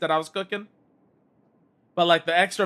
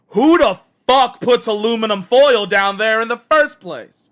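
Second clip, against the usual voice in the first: louder and higher, fed-up outburst; exasperated inner voice; 5 semitones higher, 7 dB louder than usual. Retold or imitated. Exasperated about the foil in the oven, angry louder outburst. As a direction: vent at whoever did this. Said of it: "the other part of me is like".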